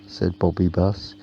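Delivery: ordinary delivery, nothing marked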